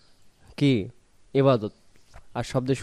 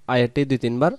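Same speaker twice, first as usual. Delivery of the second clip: normal talking voice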